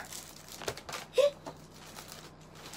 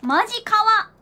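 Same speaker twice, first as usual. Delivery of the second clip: excited; 4 semitones higher, 11 dB louder than usual. Real, not acted. Gushing over schedule book.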